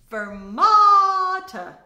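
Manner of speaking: FERMATA with a long, loud held middle syllable. Shown as a demonstration. Teacher explaining held notes, "fermata" stretched long. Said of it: "want to hold a note longer".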